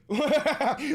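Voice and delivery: loud high laugh; real laugh at his own joke; burst of laughter. Real, not acted.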